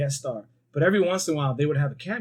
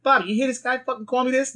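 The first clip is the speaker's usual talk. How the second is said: high, pushy Pesci-style whine; Joe Pesci impression; 9 semitones higher than usual. Pesci impression complaining to Bobby, higher whining voice. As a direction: whine it like a Jersey tough guy. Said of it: "he was like".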